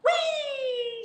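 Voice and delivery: high, loud squeal of joy; fly up squealing; gleeful whee. Acted. Rabbit jumps really high.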